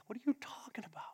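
hushed, disbelieving whisper; whispered retort. Acted. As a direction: scoff it under your breath. Crowd's scoffing reply quoted.

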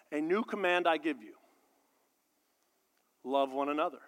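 soft, low, measured reading; quiet scripture reading. Acted. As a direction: read it softly and reverently. Reads Jesus' words quietly.

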